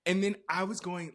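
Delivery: ordinary talk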